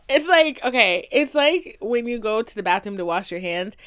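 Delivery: normal talking voice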